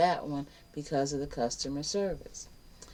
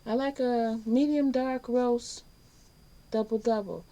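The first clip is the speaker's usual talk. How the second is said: cheerful order; 8 semitones higher, 4 dB louder than usual. Retold or imitated. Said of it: "And I say".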